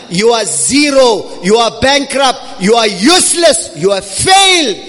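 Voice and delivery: impassioned sermon delivery; forceful preaching. Real, not acted. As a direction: preach it urgently, driving each phrase home.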